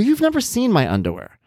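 high-pitched mock indignation; retold protest. Dad denying daughter's claim, high retold protest.

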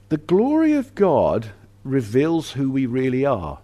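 ordinary delivery

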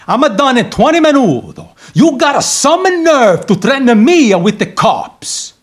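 put-on heavy Italian accent. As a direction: talk like an Italian barber. Italian barber throughout.

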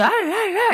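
high-pitched frantic "ah, ah, ah"; escalating mock yell. Parent escalating a freaking-out toddler, mock-frantic high cries. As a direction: imitate a parent getting worked up. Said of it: "start yelling and being heightened in emotion".

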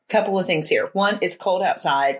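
ordinary talk

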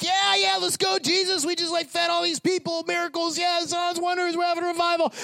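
high-pitched, hyped-up disciples; mock eager cheering, held high all through; excited disciples.

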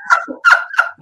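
squeaky silent laugh; real amused giggle; high breathless laugh. Real, not acted. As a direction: burst into a high wheezing laugh. Laughing at friend's outburst.

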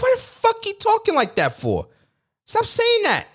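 very high-pitched mock protest; exasperated reaction. Host retells reacting to the politician's slogan, high and exasperated.

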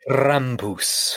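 imitated German "Krampus" with a rolled "r"; German accent. Co-host mimicking the movie's German grandma, German accent. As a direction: roll into "Krampus" with a German "poos". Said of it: "Yeah, like that".

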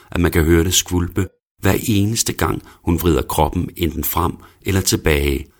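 ordinary delivery

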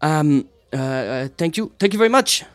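high, flustered thank-you; a nervous entertainer wrapping up; shy performer. Acted. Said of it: "she's like".

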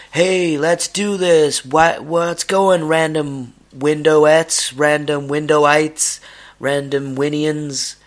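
laid-back podcast intro, stretched out; Marc Maron impression. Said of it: "If I wanted to be like Marc Maron".

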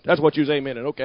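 ordinary talk

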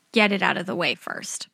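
usual speaking voice

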